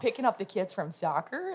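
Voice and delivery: put-on Minnesota accent.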